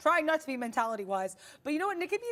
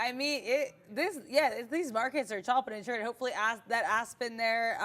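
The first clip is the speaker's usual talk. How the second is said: ordinary talk, nothing marked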